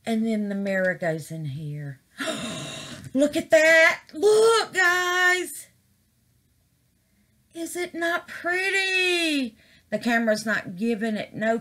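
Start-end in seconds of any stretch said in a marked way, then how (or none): 2.2-3.1 sharp gasp. Real, not acted. Reveal of finished piece. 3.1-9.6 high, loud delighted cry; overjoyed, showing the craft to viewers; thrilled reveal. Real, not acted. Thrilled reveal of resin piece.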